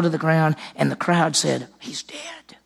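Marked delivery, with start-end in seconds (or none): 1.8-2.6 breathless whisper of shock; hushed whisper. Retold or imitated. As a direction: whisper it as a stunned crowd. Crowd gasps the boy is dead.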